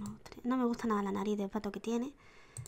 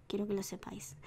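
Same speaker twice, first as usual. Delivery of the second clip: soft whisper to the viewers; confiding whisper to the audience; whispered aside; 3 semitones lower, 9 dB quieter than usual. Real, not acted. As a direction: whisper it like a secret.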